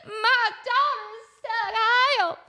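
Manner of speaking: high, thin cry for help; half-strangled mock distress call; mock panicking mom. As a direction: imitate a helpless wail without much volume. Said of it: "I'm the mom panicking".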